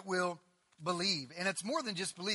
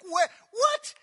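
incredulous; 14 semitones higher, 13 dB louder than usual. Retold or imitated. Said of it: "I'm like".

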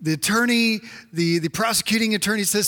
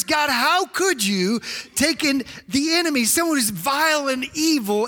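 high, indignant acted protest; Habakkuk furious; 6 semitones higher than usual. Prophet challenging God's choice, acted fury. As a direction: protest in outraged disbelief, pitched high. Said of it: "Habakkuk was furious".

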